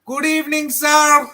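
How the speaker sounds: high-pitched mock Habib accent. Gamer greeting the chat as a running gag, Habib accent. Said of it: "with the Habib accent".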